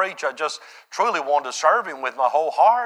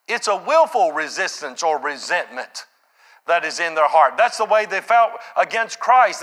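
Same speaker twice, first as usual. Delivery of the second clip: loud, high-pitched sermon delivery; full-force preaching; 10 semitones higher, 6 dB louder than usual. Real, not acted. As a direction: preach it out hard, voice lifted high.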